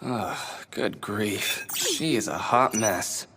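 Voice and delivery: exasperated. Acted. Exasperated sigh, slow and low.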